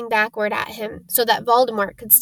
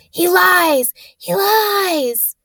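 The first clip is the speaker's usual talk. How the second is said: high, eerie hiss of a voice; an unseen voice, thin and high; ghostly high-pitched accusation; high cold voice; 8 semitones higher, 4 dB louder, much slower than usual. Acted. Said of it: "a high voice spoke".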